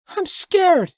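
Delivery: put-on very high child voice near tears; a small girl about to cry; scared little girl. A narrator's reading. Daughter telling her father she is afraid, high tearful child voice. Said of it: "as she began to cry".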